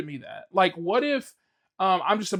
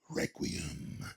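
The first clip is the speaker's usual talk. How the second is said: soft, breathy whisper of the name; whispering Requiem for spooky effect; whispered title; 12 dB quieter than usual. Acted. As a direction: hush it like a horror title. Dramatic game title.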